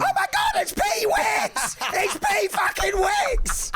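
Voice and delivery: high, slow shrieking fan; excited scream. Pretend. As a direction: scream it like a starstruck caller. Plays caller screaming at surprise.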